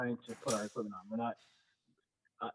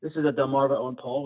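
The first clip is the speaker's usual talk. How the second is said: louder, projecting to the room; speaking up; 8 dB louder than usual. Real, not acted. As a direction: repeat it louder so the back row hears. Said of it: "speak, speak up".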